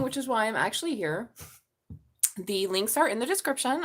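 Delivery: normal talking voice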